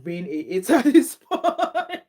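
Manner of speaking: breaking into a laugh mid-sentence; amused, laughter in the words; laughing while reading. Real, not acted. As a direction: read it out, cracking up partway through. Laughs while reading a viewer's comment.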